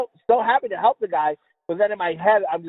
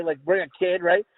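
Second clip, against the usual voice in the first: usual speaking voice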